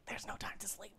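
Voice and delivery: hushed whisper imitating mom; retold whisper. Mom's urgent reply, retold in whisper.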